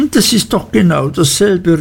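high, indignant outburst; a touch of a petulant cry. A narrator's reading. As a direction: exclaim it hurt and sure. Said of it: "rief Dora aus".

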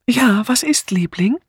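high, sing-song sweetness; fluting. A narrator's reading. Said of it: "flötete Misses Easterbrook".

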